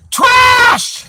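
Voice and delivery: a sudden loud high shout of disgust; yelled verdict. Real, not acted. Slamming a rival's website, yells the one word loudly. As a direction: bark the single word as a loud high yell.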